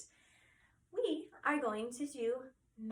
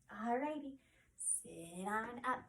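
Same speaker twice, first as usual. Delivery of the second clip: ordinary delivery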